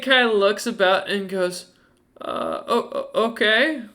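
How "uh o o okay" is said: stammering, frightened okay; nervous stammer. Acted. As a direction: stumble over it in fear.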